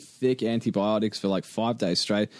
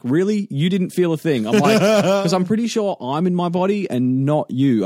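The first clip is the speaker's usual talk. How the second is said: raised, incredulous re-enacted challenge; indignant retort; 6 semitones higher, 5 dB louder than usual. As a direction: throw it back at the nurse, indignantly. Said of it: "I was so mad".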